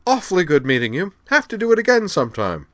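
high, loud jolly toff; cheery old sport. A narrator's reading. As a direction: boom a hearty upper-class farewell. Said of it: "said Garin cheerily".

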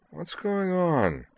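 slow, high, drowsy question; sleepy. A narrator's reading. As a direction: ask it drawn out, half asleep. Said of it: "Caitlin inquired sleepily".

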